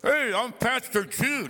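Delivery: mock the pastor's crooked-mouth delivery; imitating the pastor. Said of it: "imitate me".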